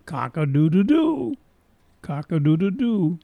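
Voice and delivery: mild imitation of a rooster crowing; sing-song imitated cock-a-doodle-doo. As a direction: crow like a distant rooster, rising and falling. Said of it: "a rooster's gonna go".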